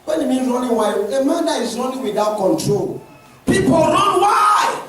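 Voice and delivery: high, forceful sermon delivery; loud, insistent pulpit preaching; full-force preaching. Real, not acted. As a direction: cry out the questions to the congregation.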